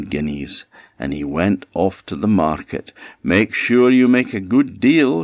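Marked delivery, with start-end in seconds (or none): 3.2-5.2 higher, louder wife calling down the road; wife shouting advice to her departing husband; shouted after him. A narrator's reading. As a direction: call out a warning as he leaves. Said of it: "shouted Missus Vinegar".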